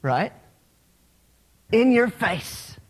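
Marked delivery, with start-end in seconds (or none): 1.7-2.8 higher, drawn-out basketball taunt. Shown as a demonstration. Preacher demonstrates a basketball player's taunt, drawn out and taunting. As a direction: crow it slowly at a beaten defender. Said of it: "the proper taunt".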